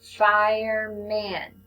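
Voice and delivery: "Fireman" drawn out slowly, every sound held; slow stretched reading. Shown as a demonstration. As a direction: read "Fireman" slowly, modeling for a beginner. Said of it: "You read it".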